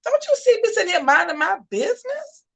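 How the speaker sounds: high-pitched, indignant lion's retort; high, affronted protest; put-upon lion. Acted. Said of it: "And the lion's like".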